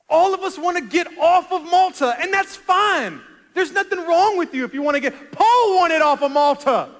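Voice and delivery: loud, high pulpit delivery; full-force preaching. Real, not acted. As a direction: drive the warning home at full voice.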